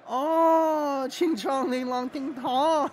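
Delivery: hammed-up high caricature; Missus Miles. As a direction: imitate a teasing girlfriend in exaggerated singsong. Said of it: "Missus Miles will be like".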